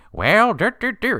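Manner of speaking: hammed-up child's Southern accent; a kid's terrible Southern accent impression; bad Southern accent. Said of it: "Do a Southern accent".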